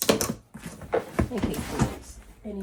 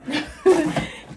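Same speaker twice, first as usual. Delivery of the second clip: giggle; 6 semitones higher, 6 dB louder than usual. Real, not acted. Laughing at herself.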